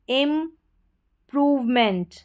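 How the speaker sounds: Improvement drawn out, प्र clearly; slow model word. Shown as a demonstration. Teacher models the word for students, slowly. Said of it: "पी आर को प्र पढ़ेंगे".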